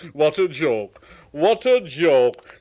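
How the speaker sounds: laughing through the words; chuckling Gordon. Acted. Gordon chuckling on his way to Thomas. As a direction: gloat with laughter.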